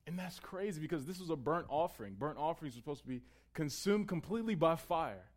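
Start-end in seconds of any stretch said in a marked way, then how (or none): none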